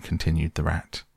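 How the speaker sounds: plain reading voice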